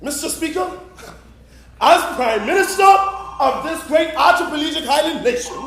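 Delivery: nail the full line like a prime minister; grand parliamentary delivery. Shown as a demonstration. Prime minister addressing the Speaker, in a grand oratorical voice. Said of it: "rehearses the line again".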